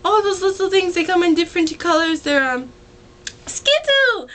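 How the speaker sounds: high-pitched singer impression, hunting for a word. Singer naming favorite candy in interview, high excited imitation. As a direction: imitate an excitable star, leaping up on the last word. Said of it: "and [name]'s like".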